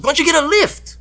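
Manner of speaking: very high mock-indignant suggestion; pushy, squeaked-up impression; imitated nagging woman. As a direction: needle him in her high voice. Imitating woman confronting him at bar.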